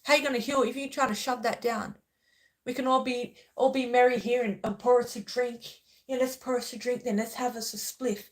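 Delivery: scornful loud rant; loud retort. Retold or imitated. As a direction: push back hard and loud. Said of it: "I'm like".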